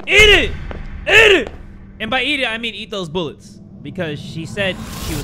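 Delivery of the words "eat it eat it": high, loud victory yell with a laugh; triumphant yelling. Real, not acted. Shooting enemy, triumphant yelling.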